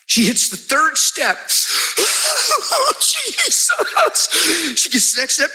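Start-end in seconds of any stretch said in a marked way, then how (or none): 2.0-4.3 much higher ecstatic "Jesus"; ecstatic cry. Retold or imitated. Healed woman stepping down stairs.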